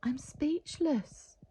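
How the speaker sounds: slow, awed whisper; stunned whisper. Acted. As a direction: breathe it out in shock. Reverend stunned by the numbers.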